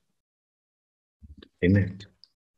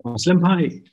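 raised, higher call to someone unseen; louder, high-pitched hail; 10 semitones higher, 5 dB louder than usual. Acted. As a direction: call his name out into the house. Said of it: "I called".

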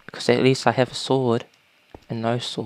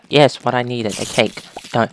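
ordinary talk, nothing marked

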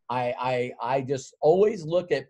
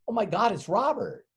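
higher, excited gasp of recognition; mock delighted secretary; 7 semitones higher than usual. Secretary greeting the returning visitor, higher and excited. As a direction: play the secretary thrilled he's back.

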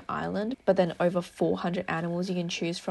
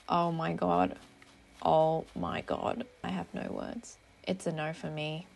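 ordinary talk

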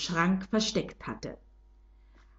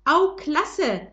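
higher, louder, thrilled little shout; bright mock cheer from the mouse; excited cry; 6 semitones higher, 4 dB louder than usual. A narrator's reading. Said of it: "schrie Fritzi begeistert".

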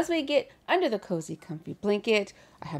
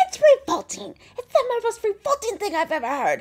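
high, loud, outraged cry; mock movie shouting; 11 semitones higher, 6 dB louder than usual. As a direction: shout it like an appalled girl in an old film. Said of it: "she just starts shouting".

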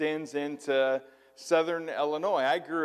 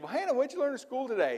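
grandmother voice; 9 semitones higher, faster than usual. Retold or imitated. Grandmother asks child about school.

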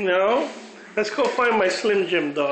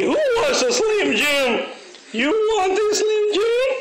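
much higher, sing-song coaxing; dog baby talk; 13 semitones higher than usual. Acted. Owner teasing the dog with a treat, high baby talk. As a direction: tease the dog with a treat, pitched way up.

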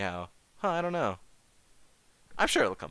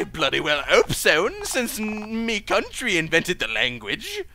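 hammed-up British accent, louder and higher; 6 semitones higher, 5 dB louder than usual. British passerby greeting a stranger, hammed-up British accent. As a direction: play a cheery, laughing British bloke. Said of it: "Are you British?".

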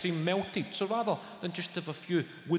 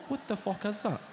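usual speaking voice

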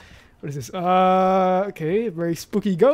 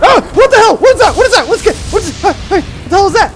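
loud, high-pitched shrieks of fright; panicked screaming; 10 semitones higher, 9 dB louder than usual. Real, not acted. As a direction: scream in panic at a sudden scare. Jump scare in horror game.

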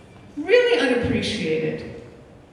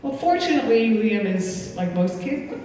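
ordinary delivery

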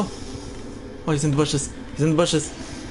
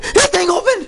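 high alarmed cry, repeated; panicked cry; 9 semitones higher, 3 dB louder than usual. Real, not acted. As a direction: still yelping in alarm. Monster wakes up.

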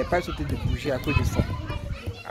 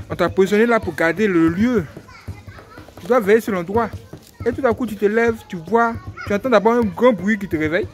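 fervent preaching; 4 semitones higher, 3 dB louder than usual. Acted. Preaching to the congregation.

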